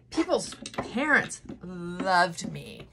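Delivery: slow, choked-up words, close to tears; real welling up; tearful. Real, not acted. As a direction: let the tears come into it slowly. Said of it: "See, I'm gonna cry again".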